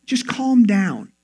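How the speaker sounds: slow, drawn-out mock soothing; telling someone to calm down. Telling an irritated loved one, slow mock soothing. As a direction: stretch out CALM patronizingly. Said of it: "telling a loved one when they're".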